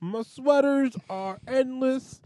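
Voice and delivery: very slow, drawn-out comedian rhyming; Bill Cosby. Acted. Host starts rhyming about sweaters, Bill Cosby impression. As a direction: declaim each phrase slowly in character. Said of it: "make you rap as Bill Cosby".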